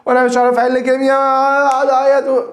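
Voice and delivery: student whining to the teacher, mock tearful; whiny student. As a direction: play a panicking student about to cry. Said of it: "طيب يا مستر".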